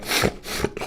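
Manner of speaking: short noisy tic from a Žižek impression; Žižek's tic. Shown as a demonstration. Streamer showing how to do a Žižek impression, demonstrated tic. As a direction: demonstrate Žižek's habitual cough-like tic, without words. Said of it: "but you need to, like".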